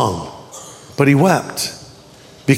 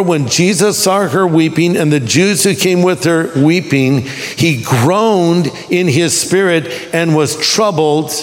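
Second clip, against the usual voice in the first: ordinary talk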